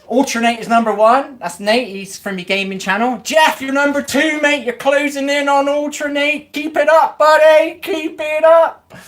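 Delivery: high, loud, slow sarcastic cheering; rally him on like a mock cheerleader; mock hype. Real, not acted. Hyping troll's chat rank.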